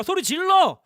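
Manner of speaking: crying out. Shown as a demonstration. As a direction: call it out high and loud. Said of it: "베드로가 소리 질렀어요". (높고 크게 외치기)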